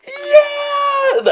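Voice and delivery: sharp gasp, then a very high, loud "Yeah!"; excited gasp and cheer. Retold or imitated. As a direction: gasp and shriek with joy like kids opening a gift. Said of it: "both of them were like".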